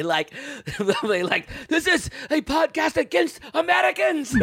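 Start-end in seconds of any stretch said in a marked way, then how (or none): 1.7-4.4 high, hammed-up Middle Eastern accent, laughing; mock Middle Eastern accent. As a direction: put on a caricatured Middle Eastern accent, pitched way up. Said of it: "With your racist accent".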